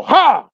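one short high "¡Ja!" barked out; single country ja. Shown as a demonstration. Imitating the one-capital-ja country laugh, a short high "¡Ja!". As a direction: throw out one sharp high ja. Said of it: "Una cosa así como".

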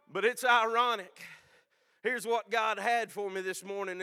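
fervent, loud testimony; preaching. Real, not acted. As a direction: preach it with mounting emotion. Preaching testimony at full force.

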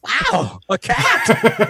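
sudden high, loud outburst; Christopher Walken. Acted. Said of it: "you gotta stick in".

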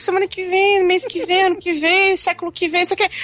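mock stalling. Littlefinger stalling in retold story.

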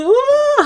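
loud, very high mock sob; crying wail. Retelling the moment the PIN failed, loud high sob. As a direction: let out a howling sob. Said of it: "hab so richtig geheult".

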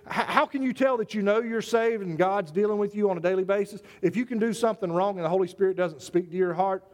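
usual speaking voice

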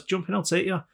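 a hint of a friendly stranger; Canadian driver. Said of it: "his impersonation of a Canadian".

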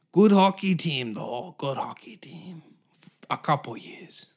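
put-on French accent bursting out high, then breathing away; hammed-up French accent; mock French accent. French voice carried on.

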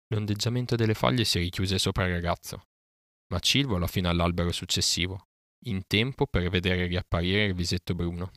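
usual speaking voice

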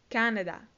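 "Canadá" said as English KAN-a-da, stress first; English pronunciation. Shown as a demonstration. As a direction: model "Canadá" with first-syllable stress, falling. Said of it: "Canadá se dice". ("Canadá" en inglés, acento en la primera sílaba)

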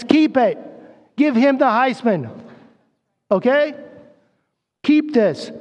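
rally them like a football coach; fired-up exhortation. Real, not acted. Preacher urging crowd to hold on.

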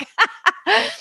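high laugh at one's own blunder. Real, not acted. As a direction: burst of high-pitched laughter.

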